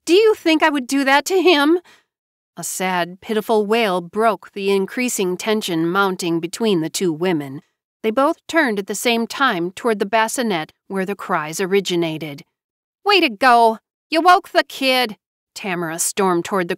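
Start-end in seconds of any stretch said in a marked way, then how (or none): 0.0-1.8 higher, louder indignant question; appalled. A narrator's reading. JoBeth defending herself to Tamara, appalled. 13.0-15.2 louder, higher sarcastic outburst; angry. A narrator's reading. Tamara blaming JoBeth for waking the baby, angry.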